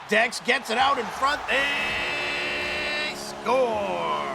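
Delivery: rising to a big high call; excited goal call. Real, not acted. Goal call in live play-by-play.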